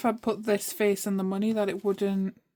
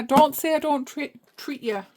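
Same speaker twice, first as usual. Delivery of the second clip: ordinary talk, nothing marked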